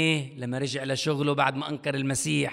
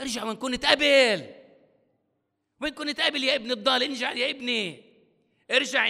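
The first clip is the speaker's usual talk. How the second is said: pleading call; 7 semitones higher, 4 dB louder than usual. Acted. God calls the prodigal home.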